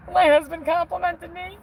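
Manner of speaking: put-on high, gushing kid voice; giddy little kid. Wife thrilled by husband's compliment, giddy little kid. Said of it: "Felt like a little stupid kid".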